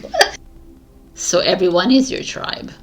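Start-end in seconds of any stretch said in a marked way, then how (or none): 0.0-0.4 mock surprised squeal, very high; shocked what. Reader retells her own reaction to the word, squealing in mock shock. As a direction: fake a jolt of disbelief. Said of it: "I was like".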